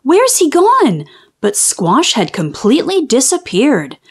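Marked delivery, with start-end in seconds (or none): 0.0-1.0 high-pitched, puzzled outcry; storybook alarm, pitched high; crying out. A narrator's reading. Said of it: "cried the whole class".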